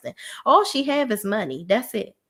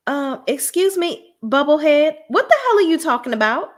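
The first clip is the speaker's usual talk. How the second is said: higher, louder outraged "excuse me"; indignant callout; 8 semitones higher, 5 dB louder than usual. Real, not acted. Calling out Noella as Bubblehead.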